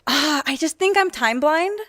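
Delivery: usual speaking voice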